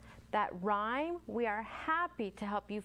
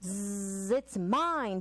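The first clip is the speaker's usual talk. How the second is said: buzzing bee; much slower than usual. Acted. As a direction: buzz the Zzz like a honeybee. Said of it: "buzzed the honeybee".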